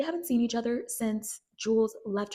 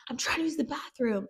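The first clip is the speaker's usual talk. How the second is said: higher annoyed voice; 6 semitones higher than usual. Retold or imitated. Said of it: "Nate says".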